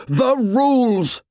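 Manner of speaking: high stretched shout. Acted. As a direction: shout the rules slowly. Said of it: "shouted Ralph".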